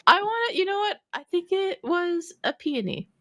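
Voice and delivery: high-pitched laughing talk. Real, not acted. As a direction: squeal it out through laughter. Laughing fit while explaining.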